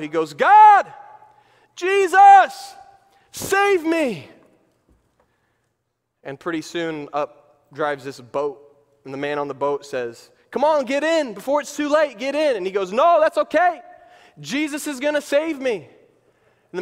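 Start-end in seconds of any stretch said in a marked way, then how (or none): 0.3-4.3 high, loud desperate plea to heaven; hammed-up cry for rescue. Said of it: "he cries out". 10.5-12.6 high, raised-voice rescuer's call; acted shout from a boat; urgent calling out. Acted boatman urging stranded man. 12.9-15.8 high, loud insistent brush-off; acted, confident holdout; stubborn refusal. Acted man refusing rescue.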